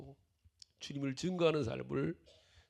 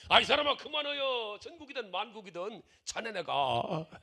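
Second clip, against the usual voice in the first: higher, louder fed-up retort; exasperated refusal; 7 semitones higher, 3 dB louder than usual. Retold or imitated. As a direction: brush off a nagging friend, voice jumping up. Exasperated friend refusing, acted retelling.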